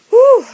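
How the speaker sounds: loud, high "whew"; high whoop. Real, not acted. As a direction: let out a sudden high hoot.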